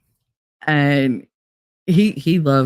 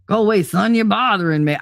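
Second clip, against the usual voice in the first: Foghorn Leghorn; 4 semitones higher than usual. Retold or imitated. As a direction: bark it like a blustery cartoon rooster. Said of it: "Foghorn Leghorn".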